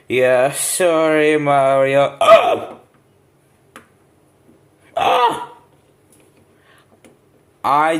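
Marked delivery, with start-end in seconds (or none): 2.2-5.5 piercing scream. Acted. Character screams in panic.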